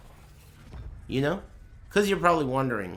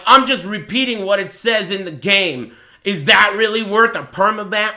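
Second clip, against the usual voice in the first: loud, higher mock protest; imitating angry viewer; 6 semitones higher, 8 dB louder than usual. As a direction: act out an indignant ban appeal, loudly. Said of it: "he's fucking angry, first of all".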